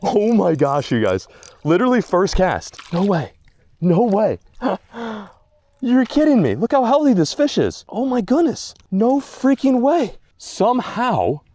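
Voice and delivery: loud, very high disbelief; thrilled, high-pitched exclaiming; amazed. Real, not acted. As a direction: cry out in real astonishment, stretching the words. Amazed at landing healthy bass.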